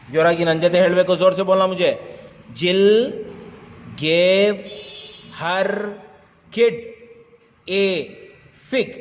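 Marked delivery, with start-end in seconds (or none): 2.6-8.9 slow, with a long pause after every word; word-by-word reading. Shown as a demonstration. Teacher models a sentence for pupils, word by word. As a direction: read each word separately for pupils to repeat. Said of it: "ಸ್ವಲ್ಪ fast ಓದೋಣ".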